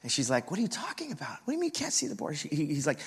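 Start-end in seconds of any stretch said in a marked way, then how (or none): 0.5-2.4 high-pitched, incredulous mock mom; squeaky-high agitated questioning. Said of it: "she's growing agitated".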